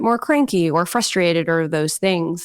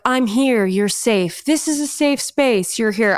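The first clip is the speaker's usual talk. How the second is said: a higher, slower, teasing imitation of over-soothing; mock soothing friend; 4 semitones higher, slower than usual. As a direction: parody a gentle parent cooing to a kid. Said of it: "she hugs the kid and is like".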